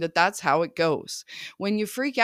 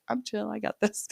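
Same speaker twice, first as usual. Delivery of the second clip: higher, softer, easy-going shrug of a voice; breezy unbothered; 5 semitones higher, 5 dB quieter than usual. Pretend. Mom picturing herself shrugging off tantrums, breezy. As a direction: toss it off like nothing can touch you.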